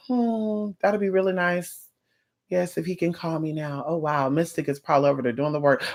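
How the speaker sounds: soft, low daydreaming to oneself; quietly imagine it, lightly acting the client; musing client. Client daydreaming while Mystic works, soft musing. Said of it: "You sit there and you say".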